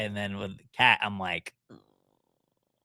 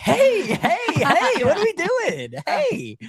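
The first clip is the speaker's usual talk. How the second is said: high, empty-headed dog chatter; dim dog voice; 3 dB louder, much slower than usual. Retold or imitated. Voicing Bear the dog, high and empty-headed. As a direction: play a happy dog with no thoughts. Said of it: "So he's just like".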